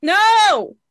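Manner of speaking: high, loud anguished cry; shouted no. Real, not acted. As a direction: yell it in dismay. Cries out at sad film memory.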